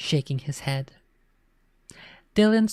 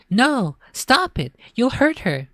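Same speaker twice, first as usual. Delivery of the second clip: much higher, slow frightened plea; alarmed cry; 9 semitones higher, 3 dB louder than usual. A narrator's reading. Girl afraid for her imaginary friend, alarmed plea. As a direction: beg him to stop in alarm. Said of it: "Ashley gasped".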